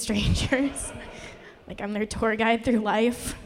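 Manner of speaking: ordinary talk, nothing marked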